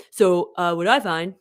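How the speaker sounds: lightly put-on low boy voice; a hint of a low boy voice; Ray Higdon. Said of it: "my Ray Higdon boy voice".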